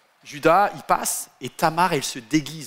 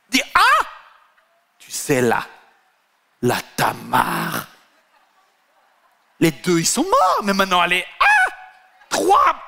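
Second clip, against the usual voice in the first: much higher, loud shrieking gossip; scandalised busybody crying out the news; mock shriek of shocked delight; gossipy outburst; 4 semitones higher, 4 dB louder than usual. Gossip acted out, shouting the news.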